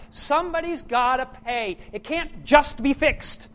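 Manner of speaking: loud, forceful sermon delivery; preaching. Acted. Pastor urging the congregation, preaching at full force. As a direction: hammer home the cost of forgiveness.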